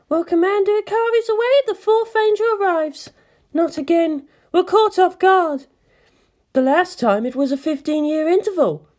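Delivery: high female officer. Acted. Officer on the bridge notes the angel's early arrival, voiced high.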